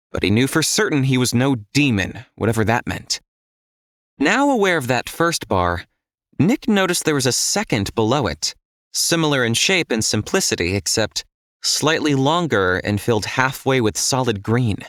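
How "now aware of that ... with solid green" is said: ordinary delivery, nothing marked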